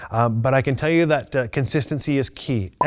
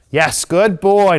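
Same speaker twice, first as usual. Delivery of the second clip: slow, drawn-out, higher and louder praise; praise for dog; 5 semitones higher, 6 dB louder, much slower than usual. Real, not acted. Trainer rewarding her dog with slow excited praise. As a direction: stretch it out for the dog.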